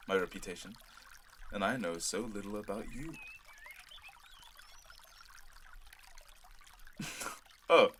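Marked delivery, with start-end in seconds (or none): 4.3-7.4 quiet airy chuckle under the breath; soft chuckle. Acted. Prince charmed by the gardener, soft airy chuckle. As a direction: let a soft amused laugh slip out.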